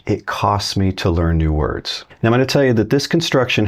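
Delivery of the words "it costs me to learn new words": drawn-out words, "it" leaned on; slow literal gloss. Shown as a demonstration. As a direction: lay out the literal sense slowly.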